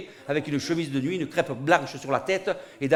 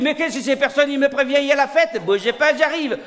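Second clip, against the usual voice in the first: Mohamed étonné et ravi; 8 semitones higher, 4 dB louder than usual. Acted. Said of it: "il dit".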